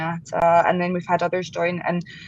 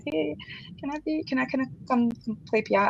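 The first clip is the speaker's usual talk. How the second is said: small, soft, higher-pitched asking; mock unconfident request; 6 semitones higher, 6 dB quieter than usual. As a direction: ask timidly, stumbling over the words in jest. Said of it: "I said it more confidently".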